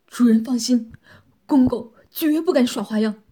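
loud, urgent submissive man's voice; hurried reassurance. A narrator's reading. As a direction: hastily swear obedience to the mistress. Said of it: "急忙说道".

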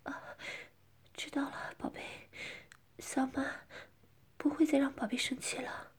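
soft, submissive coy reply; hushed, dainty promise. Acted. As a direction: answer softly and sweetly, half breath. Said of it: "我娇气地说".

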